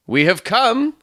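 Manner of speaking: high, formal announcement; haughty young suitor. Acted. Stangerson stating his errand to Ferrier, high and formal. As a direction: raise the pitch, announce it grandly.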